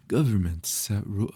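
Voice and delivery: loud bright anime character voice; VTuber anime voice. Shown as a demonstration. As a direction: voice it like an anime streamer. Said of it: "my VTuber anime voice".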